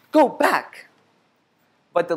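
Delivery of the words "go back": very high, louder go back; shouted. A narrator's reading. Pinwheel and Squirrel yelling at leaves, shouted. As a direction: yell it up at the leaves. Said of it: "they shouted at the leaves".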